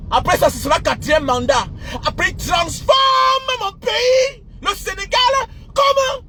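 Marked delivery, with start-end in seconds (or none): 2.1-6.2 anguished cry. Real, not acted. Anguished about his country.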